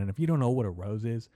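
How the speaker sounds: ordinary talk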